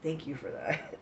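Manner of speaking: normal talking voice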